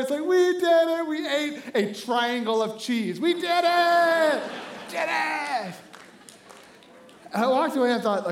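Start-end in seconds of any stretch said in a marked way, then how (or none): 0.2-5.7 high, drawn-out mock sob; hammed-up tearful victory cry; crying in triumph. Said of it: "he's crying, everybody's crying".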